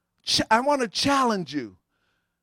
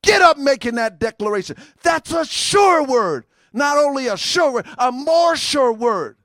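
fervent preaching; 4 semitones higher, 5 dB louder than usual. Acted. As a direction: drive home the sure word. Preaching at full force.

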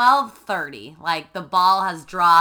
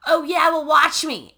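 higher and louder taunt; mock defiance; 7 semitones higher, 5 dB louder than usual. Patrick daring Peyton before sneaking home, cocky taunt. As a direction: dare her with a cocky challenge.

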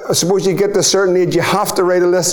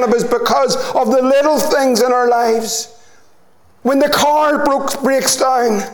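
raised, driving preacher's voice; preaching at full force; 5 semitones higher than usual. Acted. Preacher urging the congregation, fervent and raised. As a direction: build the sermon up with fervour.

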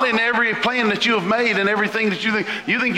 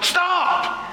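a sharp high cry of stop; sudden stop; 11 semitones higher than usual. Acted. Preacher halting the congregation's excuses, a sharp high stop. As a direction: cut the worrier off, high and sudden.

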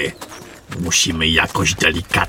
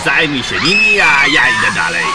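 high, loud shouting over the fight; urgent combat yell; battle shout; 6 semitones higher, 3 dB louder than usual. Acted. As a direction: yell orders in the heat of battle. Battle breaks out in ruins.

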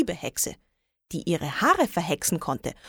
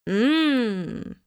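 long, higher appreciative "mmm"; savouring hum; 4 semitones higher than usual. A narrator's reading. Witch smells her chocolate hair, humming with relish. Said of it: "meinte sie".

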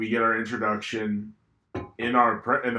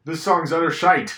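high and louder, mock British scorn; mock British accent; 6 semitones higher, 6 dB louder than usual. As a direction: sneer it like a Brit.

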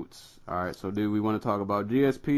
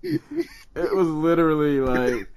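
laughing; 6 semitones higher, 5 dB louder, slower than usual. Real, not acted. Laughing over the wild story.

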